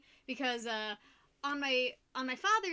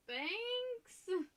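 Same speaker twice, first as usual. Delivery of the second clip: high, drawn-out, unsure "thanks"; acted awkward reaction to a backhanded compliment; dubious thanks; 9 semitones higher than usual. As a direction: question the compliment, pitched way up. Mimics reply to backhanded compliment.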